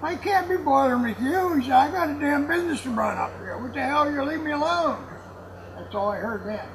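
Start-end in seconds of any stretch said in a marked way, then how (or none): none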